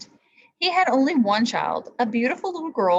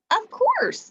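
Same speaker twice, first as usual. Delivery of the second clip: promising; 4 dB louder than usual. A narrator's reading. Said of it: "the princess promised".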